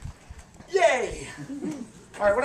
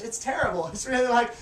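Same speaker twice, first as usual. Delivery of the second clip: ordinary talk, nothing marked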